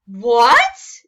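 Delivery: very loud indignant shout; outraged roar. Acted. Gabe hearing Emma isn't afraid, loud outrage. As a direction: bellow it in disbelief.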